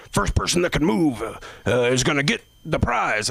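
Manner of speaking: high, showy emcee voice; mock game-show host. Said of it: "he goes".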